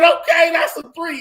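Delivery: yelling in pain at the count of three; pained shout. Retold or imitated. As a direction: scream it out as the pain hits. Man screams in pain.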